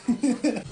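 amused real laugh. Real, not acted. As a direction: laugh at your own mishap. Laughs after admitting she's no country girl.

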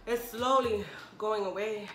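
ordinary delivery

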